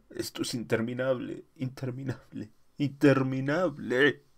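a man losing his nerve, repeating it over and over; panicked exclamation. Acted. A stranded astronaut cries out in rising panic. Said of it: "exclamó una voz".

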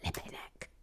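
whisper. Real, not acted. Whispered exclamation.

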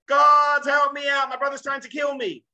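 loud, high mock cry for help; hammed-up desperate prayer, starting very loud; panicked plea. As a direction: wail it out to God in desperation. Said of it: "doesn't start his prayers like".

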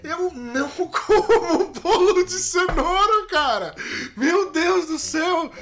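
high, slow wailing protest; hammed-up crying complaint; tearful exasperation. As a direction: whine through sobs, at breaking point. Tearful protest at relentless teasing.

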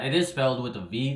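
ordinary delivery

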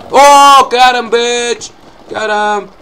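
loud, high, drawn-out gloating; triumphant yell. Real, not acted. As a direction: crow over the hit, easing off after. Hits target in game.

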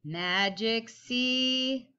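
drawn-out slow Magic C; slow tracing call. Shown as a demonstration. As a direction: stretch it slowly while tracing. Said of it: "everybody get your pencil ready".

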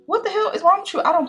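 high, loud incredulous scolding; exasperated. Retold or imitated. Storyteller scolding her date in the retelling, exasperated.

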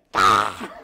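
loud, harsh answering screech; imitated crowd roar. Audience answering the stage monkey, loud imitated screech. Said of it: "the audience went".

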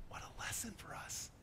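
whispered in awe. Real, not acted. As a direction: hush it to the congregation. Sermon point in awe.

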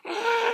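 short, high-pitched scream; sudden high yelp. Pretend. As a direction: shriek as if attacked in a game. Attacked in the game.

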